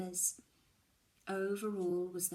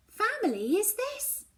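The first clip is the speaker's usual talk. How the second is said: mother explaining; 13 semitones higher, 7 dB louder than usual. Acted. Mum explains family.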